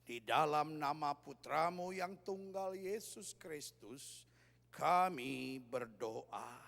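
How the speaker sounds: slow, drawn-out prayer voice; imitating a placid, ponderous prayer; solemn prayer. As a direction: intone a prayer very slowly, stretching every phrase. Mock solemn exorcism prayer.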